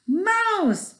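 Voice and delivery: high, loud alarmed cry of mouse; alarmed shout. Acted. Cats discovering a mouse at lunch, alarmed shout. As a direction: yell out the warning.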